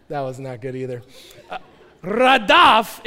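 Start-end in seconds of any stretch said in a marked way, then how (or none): 2.0-3.1 radaf with a strongly rolled R, louder and higher. Shown as a demonstration. Preacher showing youth how to roll the R in radaf. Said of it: "you gotta roll the R".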